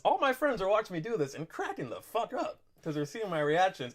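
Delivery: usual speaking voice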